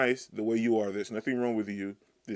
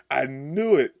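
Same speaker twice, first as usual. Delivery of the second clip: ordinary talk, nothing marked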